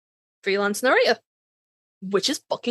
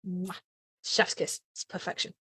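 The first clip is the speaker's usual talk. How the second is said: fading into a soft whisper of admiration; whispered praise; 4 semitones lower, 12 dB quieter than usual. Real, not acted. Praising Sandra's Dean voice, trailing into a whisper. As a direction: drop to a whisper as if savouring it.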